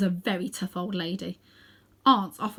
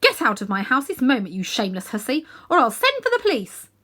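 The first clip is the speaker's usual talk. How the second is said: loud, high indignant scolding; indignant order; 4 semitones higher, 7 dB louder than usual. A narrator's reading. Aunt Letty ordering the witch out of her house.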